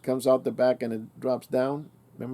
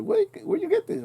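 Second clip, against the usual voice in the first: amazed question. Retold or imitated. Friend shows off new car.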